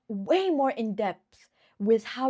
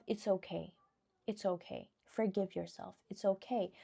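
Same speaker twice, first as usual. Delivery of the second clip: much softer, gentle self-comfort; soft reassurance; 3 semitones lower, 10 dB quieter than usual. Pretend. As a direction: soothe yourself like a hurt child. Soothing self-talk, much softer.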